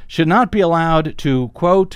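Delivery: normal talking voice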